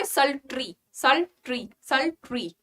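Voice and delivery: sultry with a short ri ending, repeated; pronunciation demo. Shown as a demonstration. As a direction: drill sultry three times, ending in ri. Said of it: "क्या बोलोगे?".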